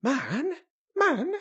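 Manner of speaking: high, startled echo; two short, high exclamations; quick startled cries. A narrator's reading. Dickon and Mary startled by Colin's news, quick cries. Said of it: "both cried in low, quick voices".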